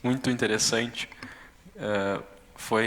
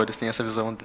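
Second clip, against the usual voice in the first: ordinary delivery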